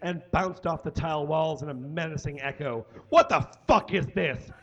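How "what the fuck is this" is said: angry outburst. A narrator's reading. Chef appalled at a dish, angry outburst. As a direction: demand it, barely holding the voice together. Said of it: "keep his voice from breaking".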